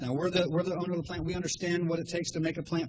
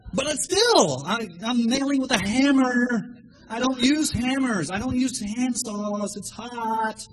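mock complaining; 6 semitones higher, 6 dB louder, slower than usual.

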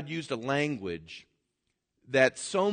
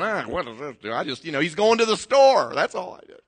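louder, whiny mock congregation voice; mock bored listener; 3 semitones higher, 8 dB louder than usual. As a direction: sound fed up and puzzled. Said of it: "you're all sitting here going".

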